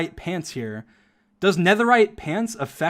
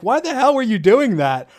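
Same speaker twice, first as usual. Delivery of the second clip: mock yell; 7 semitones higher than usual. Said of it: "Sapnap was, like, yelling at him".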